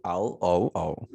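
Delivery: L O O spelled slowly, letter by letter. Shown as a demonstration. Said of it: "Now, loo".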